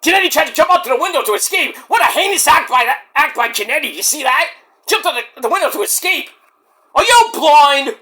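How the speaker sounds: high, fast, outraged ringside call; wrestling commentator. Acted. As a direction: hype up the heel move like a ringside announcer.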